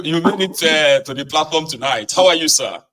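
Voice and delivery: ordinary delivery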